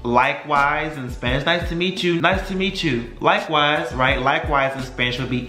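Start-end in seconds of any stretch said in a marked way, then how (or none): none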